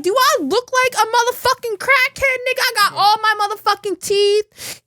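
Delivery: very high, incredulous retort; indignant protest. Retold or imitated. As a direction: act out being insulted by the accusation. Acted protest at crackhead accusation.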